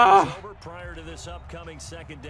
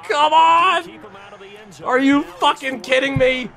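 furious shout; 11 semitones higher than usual. Real, not acted. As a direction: rage at the game's outcome. Raging at the failed play.

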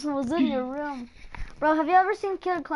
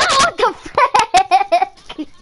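high loud scared outburst; startled scream; 4 semitones higher, 6 dB louder, much faster than usual. Real, not acted. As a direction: scream it in sudden fright. Jump scare in horror game.

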